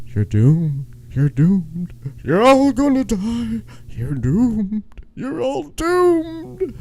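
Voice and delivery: put-on high, eerie crazy old man warning; slow ominous raspy drawl. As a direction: stretch out the last doom slowly. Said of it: "the old guy on the bike".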